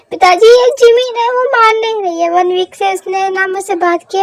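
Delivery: desperate plea. Acted. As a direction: beg dad loudly for help. Son desperately calls father for help.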